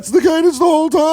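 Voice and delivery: Seinfeld impression. Imitating Seinfeld for a friend. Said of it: "Or think about Seinfeld".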